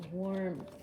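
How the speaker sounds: normal talking voice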